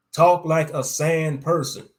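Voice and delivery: fed-up demanding shout. Acted. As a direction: insist hard, nearly shouting. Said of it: "I demanded".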